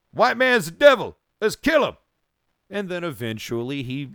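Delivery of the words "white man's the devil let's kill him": high, loud, furious preacher voice; fiery militant shout. Retold or imitated. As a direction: bellow it like a firebrand. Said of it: "Malcolm X was".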